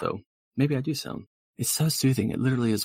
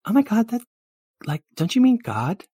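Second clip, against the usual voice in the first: put-on high, excited girly voice; volunteer in a high girlish gush; high-pitched eager girl; gushing valley girl, eager; 13 semitones higher, 7 dB louder than usual.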